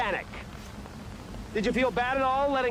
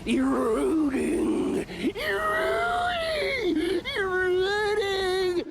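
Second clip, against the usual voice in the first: very slow, high, wailing cry; wailing sob; 9 semitones higher, much slower than usual. Acted. Bitter reporter wailing on live TV, crying. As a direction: stretch each word into an anguished wail.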